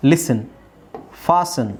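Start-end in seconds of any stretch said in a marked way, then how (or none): 1.3-1.7 ಫಾಸನ್ as "faa-sen"; silent T. Shown as a demonstration. Teacher models ಫಾಸನ್ for learners, silent T. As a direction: say ಫಾಸನ್ with no T after the S. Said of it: "ಇಲ್ಲಿ ಟಿ silent ಆಗುತ್ತೆ".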